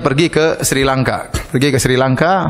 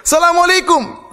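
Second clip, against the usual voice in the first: loud greeting shout. Retold or imitated. Preacher retelling the sheikh greeting the idol, shouting. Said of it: "saya sengaja keras".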